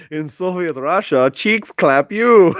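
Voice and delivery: put-on Russian accent, rising to a slow, high punchline; mock Russian accent. Telling a Soviet Russia joke, hammed-up Russian accent. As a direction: land the joke in comic Russian. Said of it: "I know how speak Russian accent".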